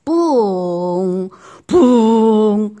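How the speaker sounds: long drawn-out ppung, very loud; booming mock fart; loud fart sound. Shown as a demonstration. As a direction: blast out each ppung. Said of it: "소리로 나타내는".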